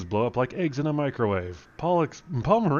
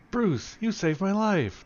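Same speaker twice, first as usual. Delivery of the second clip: lightly voiced lady, higher and slow; a hint of a grateful lady; scientist lady; 10 semitones higher, slower than usual. Scientist lady thanking Bruce, lightly voiced higher.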